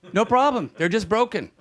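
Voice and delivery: high-voiced mock doctor; imitating an unbothered doctor; breezy doctor impression. Doctor's reply in anecdote.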